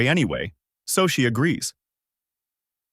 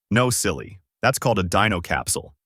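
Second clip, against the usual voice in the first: plain reading voice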